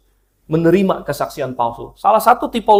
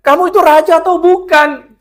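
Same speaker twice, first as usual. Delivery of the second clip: scornful queen; 11 semitones higher, 4 dB louder than usual. Retold or imitated. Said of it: "Isabel itu teringat".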